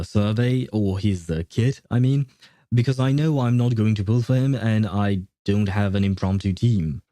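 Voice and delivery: usual speaking voice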